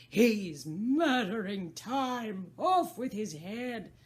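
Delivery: very loud, slow furious bellow; the Queen of Hearts bawling an order. Acted. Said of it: "the Queen bawled out".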